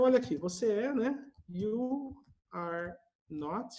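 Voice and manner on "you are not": drawn out, word by word; teacher spelling it out slowly for students; slow model phrase. Shown as a demonstration. Teacher models the full form for students, drawn out slowly. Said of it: "ou simplesmente".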